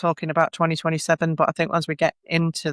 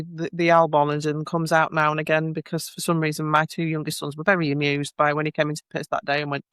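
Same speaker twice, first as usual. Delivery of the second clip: ordinary delivery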